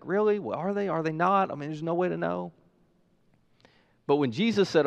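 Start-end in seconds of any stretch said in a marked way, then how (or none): 0.0-1.5 mock doubting. Acting out a doubting crowd.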